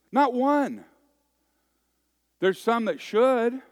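high, loud, declaiming; emphatic preaching. Real, not acted. Pastor insisting to the congregation, loud and high. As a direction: hammer the point home.

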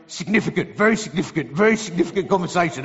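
low, repetitive mimicry of a friend; excitable acquaintance impression. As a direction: mimic his habit of repeating the word. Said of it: "That's just how he speaks".

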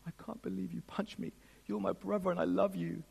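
soft, quiet tearful plea; a touch of a weeping younger brother. Retold or imitated. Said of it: "He says ... in tears".

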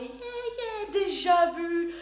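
bored yeah yeah, pitched high then dragged out slowly; blasé. Retold or imitated. Congregation shrugs off a familiar chapter, blasé. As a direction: brush it off as old news. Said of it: "blasé".

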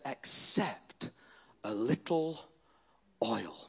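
low, soft, with pauses between phrases; hushed quote. Retold or imitated. Preacher quoting the widow to the church, hushed and halting. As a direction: let it sink down to a very quiet last word.

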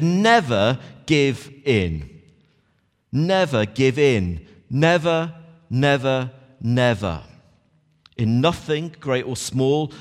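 slow, emphatic NEVER give in; Churchill quote. Retold or imitated. Preacher quoting Churchill to the church, slow and emphatic. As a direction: lean hard on each never. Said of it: "I'm not gonna do it in his accent".

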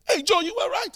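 high, loud excited dad impression; amazed father. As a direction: imitate dad exclaiming his discovery. Dad concedes after the election.

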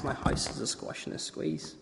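soft whispered tired refrain; whispering. Acted. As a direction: whisper it, worn out. Whispered repeat.

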